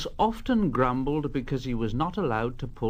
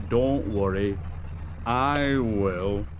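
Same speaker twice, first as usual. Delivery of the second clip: smug big engine; 5 semitones lower, slower than usual. Acted. Gordon's smug reply.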